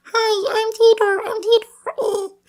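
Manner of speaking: very high, slow, loud squeaky chipmunk; Alvin and the Chipmunks. Shown as a demonstration. Dry-throated attempt at a cartoon voice, squeaky chipmunk. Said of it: "an Alvin and the Chipmunks voice".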